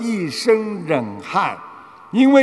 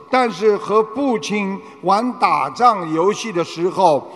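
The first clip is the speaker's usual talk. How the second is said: usual speaking voice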